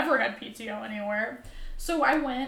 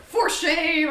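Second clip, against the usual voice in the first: loud, higher mock outrage; mock scolding; 5 semitones higher, 9 dB louder than usual. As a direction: scold a friend in jest.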